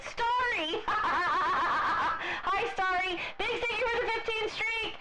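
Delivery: high, bright squeal of thanks; excited greeting. Real, not acted. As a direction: gush over the viewer.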